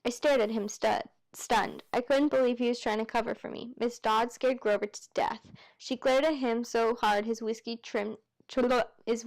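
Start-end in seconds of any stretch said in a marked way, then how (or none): none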